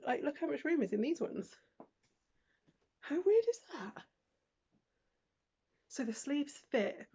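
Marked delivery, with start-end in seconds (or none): 3.0-4.0 high, amazed question. Real, not acted. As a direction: marvel at it. Amazed at room.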